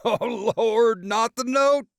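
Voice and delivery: very high, loud cackling cry; cackling brother. A narrator's reading. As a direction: laugh out the words in disbelief. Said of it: "already cackling".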